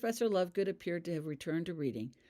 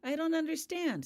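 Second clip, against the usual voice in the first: much higher, baffled; confused; 12 semitones higher, 3 dB louder than usual. A narrator's reading. Sean to Holly, confused and much higher. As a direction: puzzle it out aloud.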